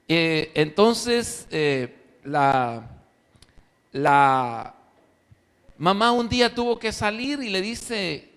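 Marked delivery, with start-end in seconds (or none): none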